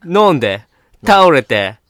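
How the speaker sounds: gyaru speech. Acted. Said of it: "ヒップホッパー".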